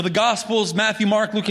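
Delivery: ordinary talk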